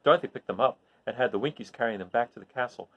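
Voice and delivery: ordinary delivery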